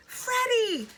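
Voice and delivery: very high, loud scream. A narrator's reading. Elizabeth screams again for Freddie, very high and loud. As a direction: scream his name desperately. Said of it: "screamed Elizabeth again".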